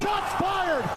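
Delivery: high hammed-up shout; shots fired shout. Streamer reacts to a roast with a high comic shout. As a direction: yell it like a comic announcer.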